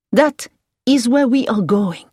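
enthusiastic French driver. A narrator's reading. Driver answers Hilary about the lonely place, emphatic French driver. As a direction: explain emphatically as a keen local driver.

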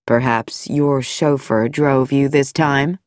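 a veiled threat from the doctor; sinister doctor. Acted. Dr Nicholson voiced.

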